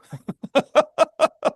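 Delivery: a real laugh at his own joke; high burst of laughter. Real, not acted.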